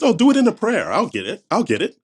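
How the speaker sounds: lightly voiced God, starting high; jokey God voice. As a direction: brush off a complainer, pitch jumping about. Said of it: "if there is a God".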